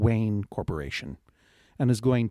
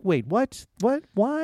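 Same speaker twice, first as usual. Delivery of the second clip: baffled; 9 semitones higher, slower than usual. Retold or imitated. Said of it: "I was like".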